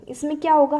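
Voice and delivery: usual speaking voice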